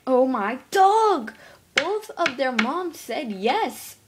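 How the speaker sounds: slow, drawn-out excited cry; overjoyed, stretch every word; girl bursting with excitement; thrilled. Pretend. Kid thrilled by news.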